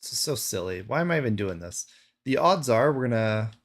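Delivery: usual speaking voice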